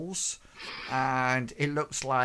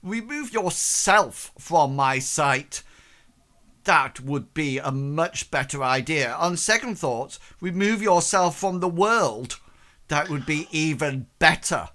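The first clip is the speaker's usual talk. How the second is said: scornful noblewoman ordering him gone, louder; contemptuous dismissal; 3 semitones higher, 6 dB louder than usual. Acted. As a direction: banish him with each line. Lady dismisses suitor in contempt.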